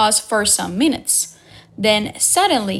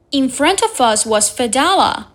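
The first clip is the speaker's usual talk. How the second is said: ordinary delivery, nothing marked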